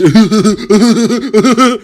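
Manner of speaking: high, rippling mock cackle; imitated female sinister laugh. Woman warning her ex, high scheming cackle. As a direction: cackle like someone plotting something crazy. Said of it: "like, that, that laugh".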